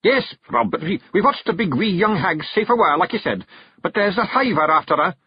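high, urgent Scottish pictsie voice; blurted Feegle report. Acted. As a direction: hurry the news out. Hamish blurts news.

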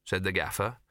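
ordinary delivery

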